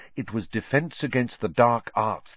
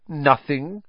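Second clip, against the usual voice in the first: higher-pitched, superior dismissal; lofty; 7 semitones higher, 4 dB louder than usual. A narrator's reading. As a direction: brush it off from on high. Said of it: "said Hermione in a lofty voice".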